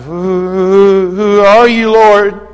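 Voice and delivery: stammered, awed question; stammering fear. Acted. Saul struck down, asking the voice, frightened stammer. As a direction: falter out the question in awe. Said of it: "imagine he might have said it like this".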